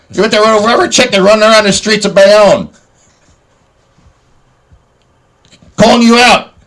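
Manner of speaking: high, loud mock challenge, hammed up, building to a full shout; hammed-up callout. A man calls out his rival Captain Bayonne, loud and high. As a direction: yell the challenge up and out like a rally cry. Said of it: "I'm gonna make a clip".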